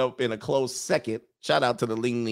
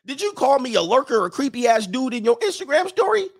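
much higher, indignant rejected guy; offended guy; 11 semitones higher, 4 dB louder than usual. Acted. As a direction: play the wounded dude calling her out. Rejected guy confronts woman.

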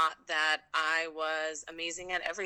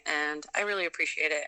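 ordinary delivery

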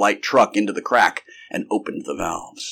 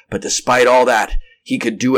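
ordinary delivery, nothing marked

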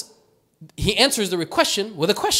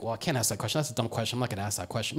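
muttered under the breath, much softer and lower; hushed inner thought; 8 semitones lower, 10 dB quieter, faster than usual. Retold or imitated. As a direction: murmur it like a thought you'd never say aloud. Crowd's unspoken self-talk.

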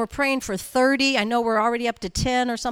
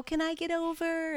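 mock sweet request; 6 semitones higher, 5 dB quieter than usual. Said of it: "they sweetly go".

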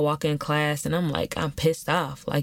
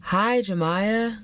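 drawn-out, higher sing-along greeting; mock group greeting; 6 semitones higher, much slower than usual. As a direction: greet in slow unison like a support group. Mimics a support group greeting.

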